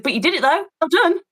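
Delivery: high, chirpy congratulation; mock praise. Drill coach congratulating the drained woman, mock cheery praise.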